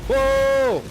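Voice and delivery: loud, high-pitched cry of alarm; startled whoa. Real, not acted. Streamer nearly blown up by explosive stuff, startled.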